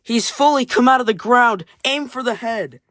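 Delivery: high, loud battle order; rally the troops to fire; urgent command. Acted. Penguin commander orders the attack on the drill, urgent shout.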